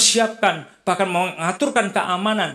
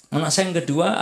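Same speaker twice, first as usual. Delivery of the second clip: normal talking voice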